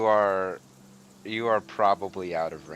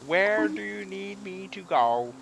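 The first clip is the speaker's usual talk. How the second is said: slow, much higher ancient tree voice; talking tree; 11 semitones higher than usual. Acted. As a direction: ask slowly like a waking old tree. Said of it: "The tree creaks down".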